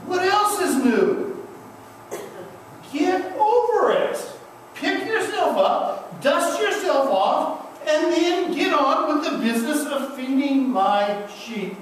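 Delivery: Jesus shrugging at our failings; Jesus, amused. Retold or imitated. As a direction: shrug it off like an old friend would. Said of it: "He looks at us and says".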